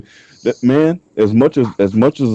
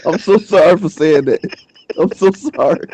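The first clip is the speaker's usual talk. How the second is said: higher, laughing mock contrition; laughing apology; 10 semitones higher than usual. Real, not acted. Apologizing to the birthday girl, laughing. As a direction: apologize while still laughing.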